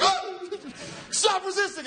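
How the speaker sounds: high, strained hammed-up cop voice; squeaky mock arrest shout. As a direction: play a cop wrestling a suspect. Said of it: "It'd be like".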